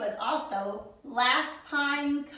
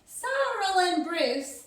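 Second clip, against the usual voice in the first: plain reading voice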